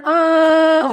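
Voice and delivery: a high, loud mock wail. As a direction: ham up a fake cry, short and high. Imitating a male crying emoticon. (ratapan pura-pura, tinggi dan keras)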